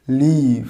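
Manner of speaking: লিভ with a long drawn "ee"; model pronunciation. Shown as a demonstration. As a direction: stretch লিভ into "leeev". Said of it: "প্রথম ওয়ার্ডটার উচ্চারণ হবে".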